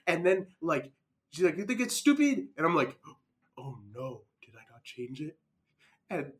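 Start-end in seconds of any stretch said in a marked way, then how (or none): 1.6-2.5 high-pitched mock French accent. Teacher confronting student over title, French accent. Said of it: "I'm gonna do the French voice for her". 3.6-5.4 soft, low, dawning realization; sheepish dismay. Retold or imitated. Student realizing he forgot to change title, sheepish.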